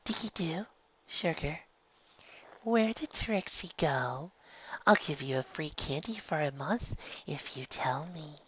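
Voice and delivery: soft sugary wheedling; softened coaxing. A narrator's reading. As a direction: sweet-talk the filly with a bribe. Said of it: "gaze softening a little".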